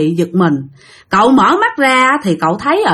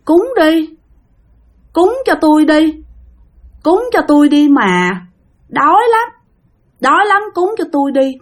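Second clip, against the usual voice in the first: high, hollow begging ghost voice; drowned girl's ghost begging for offerings, high; ghost girl pleading; 7 semitones higher than usual. Retold or imitated. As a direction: plead like a starving spirit. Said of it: "cô đó nói rằng á là".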